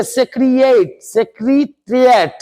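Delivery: सेक्रेटेरिएट said slowly, syllable by syllable; slow spelling-out pronunciation. Shown as a demonstration. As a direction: break सेक्रेटेरिएट into slow parts. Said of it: "सेक्रेटेरिएट माने होता है सचिवालय". (धीमा उच्चारण)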